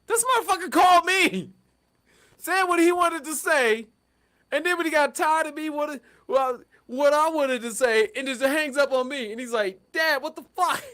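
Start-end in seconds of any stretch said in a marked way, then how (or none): 0.1-9.3 much higher, louder, outraged complaint; outraged son. Retold or imitated. Son complains about his dad. 9.9-10.8 much higher exasperated cry; outraged son. Retold or imitated. Son confronts his dad.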